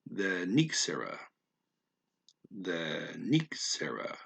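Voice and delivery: the N running into Ixera as the Nixera; blended Nixera. Shown as a demonstration. Learner sounding out the blended Nixera. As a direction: link the N straight onto Ixera, the Nixera. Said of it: "You end up going".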